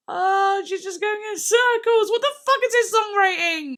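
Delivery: high and loud, in thrilled disbelief; mock-outraged praise, shrieking with delight; excited shriek. Real, not acted. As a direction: squeal it like the song just floored you. Overwhelmed by the lyric.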